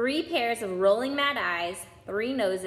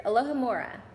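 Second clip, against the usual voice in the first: ordinary delivery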